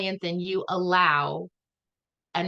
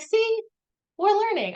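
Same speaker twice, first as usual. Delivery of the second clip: much higher, excited, triumphant; excited pride; 14 semitones higher, 3 dB louder than usual. Retold or imitated. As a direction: squeal it out triumphantly. Proud she understood the spirit.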